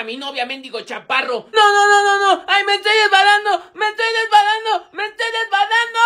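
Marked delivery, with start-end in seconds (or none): 1.5-6.0 high, loud, repeated cries of alarm; panicked yelling. Acted. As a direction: cry out as you slip from their hands. Slipping while being swung about. (gritos agudos de pánico)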